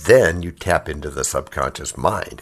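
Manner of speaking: ordinary talk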